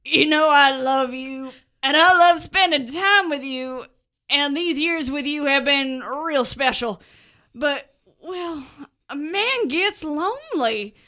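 heartfelt, hesitant confession to a daughter; Big Joe, earnest and awkward. Acted. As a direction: work up awkwardly to big news.

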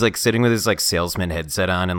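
usual speaking voice

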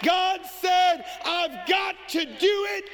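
full-force preacher building to a roar; preaching at full force. Acted. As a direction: drive it home to the congregation. Preaching climax.

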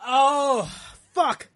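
loud, high, broken-up curse; real cry of pain; pained swearing. Real, not acted. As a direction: curse out in agony. Burn in nose, swearing.

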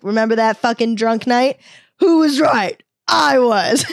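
loud, high playful I-told-you-so; hammed-up victory boast; mock gloating. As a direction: crow in pretend triumph, breaking into a laugh. Imagines gloating she was right.

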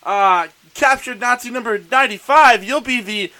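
mock pompous official. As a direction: announce the ridiculous title like a pompous bureaucrat. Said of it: "So it's like".

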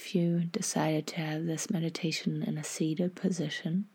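half-whispered, unhurried guidance; guided meditation. Acted.

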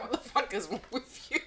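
laughing through it; giggling as she says it. Retold or imitated. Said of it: "It was just like".

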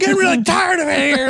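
high voice through laughter; laughing mock voice. Cohost imitating the Ghostbusters actor, laughing high. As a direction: giggle through a mock line.

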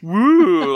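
loud, high "woo"; mock cartoon whoop. Co-host mocking a rake-in-the-face gag, loud goofy whoop. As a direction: whoop it out like a slapstick face-twist. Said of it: "his lips went".

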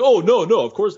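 high, flustered denial; flustered backpedal. Retold or imitated. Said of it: "the guy's like".

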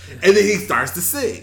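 usual speaking voice

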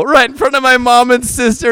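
normal talking voice

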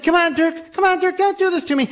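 put-on a scared kid's high, pleading cry; panicked pleading. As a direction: beg your frozen friend in terror. Panicked boy pleading with friend.

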